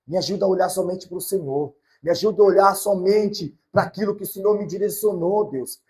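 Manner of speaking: rising, loud pleading prayer to God; preacher praying aloud; fervent prayer. Acted. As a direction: pray it out with urgency.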